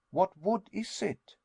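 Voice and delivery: astonished. A narrator's reading. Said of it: "I cried".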